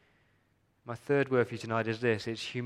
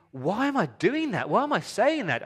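high, louder, baffled at yourself; exasperated self-questioning; 10 semitones higher, 5 dB louder than usual. Retold or imitated. Preacher voicing a man catching himself mid-argument, high and loud.

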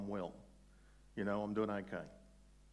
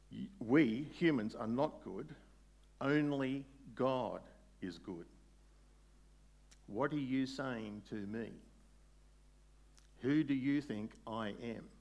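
slow earnest; 2 semitones higher, 4 dB louder, slower than usual. Acted. Jesus voiced slowly and earnestly.

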